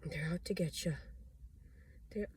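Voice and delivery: hushed, frightened warning; fearful whisper. Real, not acted. Warning listeners of enemies, fearful whisper. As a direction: whisper it like they might hear.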